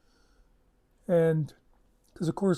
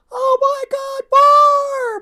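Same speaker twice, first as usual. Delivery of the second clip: piercing, drawn-out shriek, far higher and louder; screech; 13 dB louder, much slower than usual. Retold or imitated. Ellie spotting Barb off the bus, imitated delighted screech. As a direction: screech it out slowly at full pitch. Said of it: "I hear her screech".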